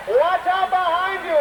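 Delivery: high, urgent cry, held a bit longer; urgent warning. Acted. Announcer warns the performers again, high and urgent. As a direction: shout it out to save them.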